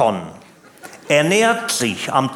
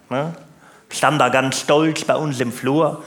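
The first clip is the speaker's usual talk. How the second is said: ordinary talk, nothing marked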